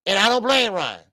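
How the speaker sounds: normal talking voice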